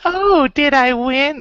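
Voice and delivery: high, slow, breathy-free princess squeak; a bad Princess Peach impression; cartoon princess. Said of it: "a really bad Princess Peach impression".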